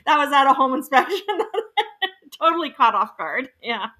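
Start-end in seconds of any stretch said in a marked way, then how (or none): none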